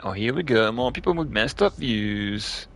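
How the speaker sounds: ordinary delivery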